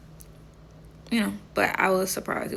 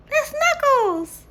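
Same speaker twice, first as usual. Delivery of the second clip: mock fan voice; 3 dB louder, much slower than usual. Crowd recognizing Knuckles costume.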